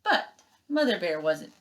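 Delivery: plain reading voice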